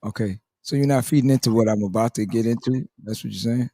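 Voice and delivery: on-air host asking a guest, in character, low and measured; low, soft persona voice, coolly checking. Acted. Host asking if she's feeding in, in character. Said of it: "I'm still in character".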